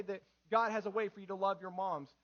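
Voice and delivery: ordinary delivery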